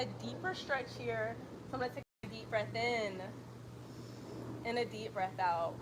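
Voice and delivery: calm, even yoga class cues; steady instructor voice pacing the breath; yoga instructor cueing. Acted. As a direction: guide the class through each breath. Leads a yoga flow.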